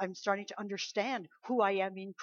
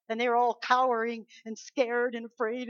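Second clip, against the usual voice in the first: ordinary talk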